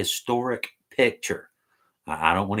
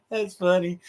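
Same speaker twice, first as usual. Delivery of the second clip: laughing; 7 semitones higher than usual. Real, not acted. Laughing fit on a livestream.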